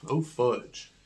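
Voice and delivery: normal talking voice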